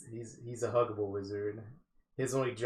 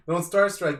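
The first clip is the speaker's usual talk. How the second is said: laughing; 8 semitones higher, 10 dB louder than usual. Real, not acted. Laughs while explaining.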